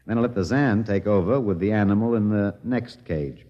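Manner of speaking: normal talking voice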